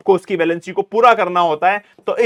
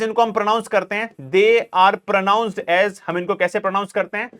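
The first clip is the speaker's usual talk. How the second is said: ordinary talk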